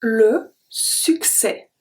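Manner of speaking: "le succès" drawn out slowly, stress on "le"; slow demo. Shown as a demonstration. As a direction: stretch "le succès" so it's easy to copy. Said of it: "And finally".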